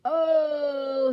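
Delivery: long, very loud, high "oh"; sustained falling cry; yell. A narrator's reading. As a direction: yell while whizzing downward. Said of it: "he yelled".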